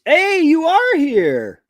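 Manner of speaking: high, slow, loud hello; delighted surprise at a new arrival; surprised greeting. Real, not acted. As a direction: break off the sentence to greet a friend. Spots a viewer arriving mid-sentence.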